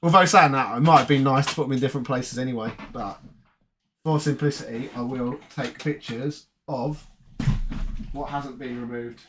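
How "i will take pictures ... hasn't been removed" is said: quiet aside. Real, not acted. Quiet aside while photographing.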